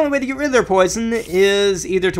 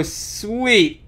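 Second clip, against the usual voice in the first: ordinary delivery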